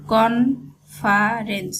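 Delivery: Conference stretched slowly, every syllable drawn out. Shown as a demonstration. Teacher introducing a new word to students, slowly drawn out. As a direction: spell out conference slowly by sound. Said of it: "এখানে স উচ্চারণ হয়েছে".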